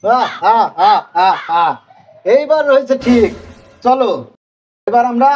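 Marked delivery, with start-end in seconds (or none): 0.0-1.7 gloating villain cackle; evil laugh. Acted. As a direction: cackle like a scheming demon. Devil boss gloats after disguising.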